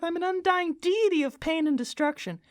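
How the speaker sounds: high-pitched cosmic being introducing itself; high voice at odds with a destructive deity; undying deity character. Acted. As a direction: present yourself in a high, put-on voice.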